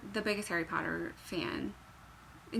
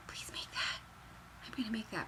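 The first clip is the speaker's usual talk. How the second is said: pleading soft whisper; 11 dB quieter than usual. Real, not acted. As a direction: whisper the plea eagerly. Whispering excited plea.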